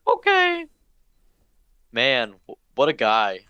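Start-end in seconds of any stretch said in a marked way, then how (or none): 0.1-0.7 high squeaky "okay"; Mickey Mouse. Pretend. Character told to do his homework, squeaky cartoon mouse. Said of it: "stop being Mickey Mouse".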